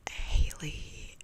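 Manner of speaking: ordinary talk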